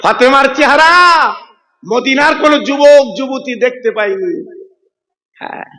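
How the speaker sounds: chanted waz narration with long-held line endings; melodic sermon storytelling. Acted. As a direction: intone the story, stretching the last syllables. (সুরেলা ওয়াজের টানা সুরে)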